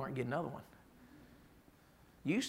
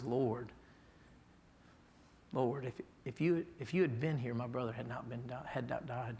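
softer, broken, halting plea; weeping plea; 5 semitones lower, 6 dB quieter than usual. Retold or imitated. Mary at Jesus' feet, softly tearful. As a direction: falter through it, mild tearful. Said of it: "one falls to his feet weeping".

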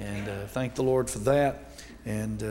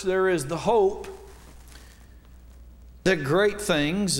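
preaching; 3 semitones higher than usual. Acted. Baptist preacher opening his sermon to the church at full preaching volume. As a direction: lift it up and preach with force.

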